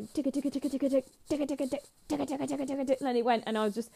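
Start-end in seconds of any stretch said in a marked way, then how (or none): 0.1-3.0 rapid-fire ticking riff, pitched up; imitated fast music. Band comes on and music starts, imitated ticking beat. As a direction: mimic a racing metal intro. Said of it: "the music started".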